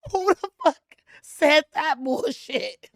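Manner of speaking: shrieking through laughter; laughing too hard to get it out; laughing disbelief. Real, not acted. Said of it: "bring her face back".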